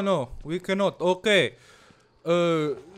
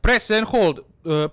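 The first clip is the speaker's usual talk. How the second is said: ordinary talk, nothing marked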